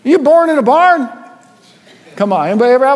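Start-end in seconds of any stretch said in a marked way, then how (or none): 0.0-1.1 loud, higher mock mother scolding; mother scolding a child who acts like an animal, mock yell. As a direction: play the exasperated mom, rising into a yell. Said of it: "a mother who said".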